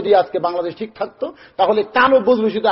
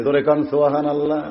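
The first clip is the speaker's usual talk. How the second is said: ordinary talk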